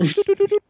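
high-pitched "doo" beeps, like a gadget; sing-song electronic beeping, far above speaking pitch; imitated locator beeps. Guy showing how his brain finds the trail, mock locator beeps.

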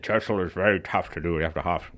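lightly voiced Churchill impression. Shown as a demonstration. Narrator showing how tough Churchill is to voice, light impression. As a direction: try on a hint of the statesman's voice. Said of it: "Churchill is one of the characters".

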